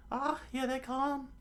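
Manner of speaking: very high squeaky alarm; high cartoon shock. A narrator's reading. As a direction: squeak the warning in falsetto. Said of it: "Nami said in, in shock".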